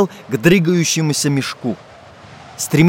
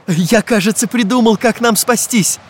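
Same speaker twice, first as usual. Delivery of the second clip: raised, gleeful exclamation; cheerful cry; 7 semitones higher than usual. A narrator's reading. Карандаш has a plan to save everyone, calling out cheerfully. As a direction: announce the idea with delight. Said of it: "весело закричал Карандаш".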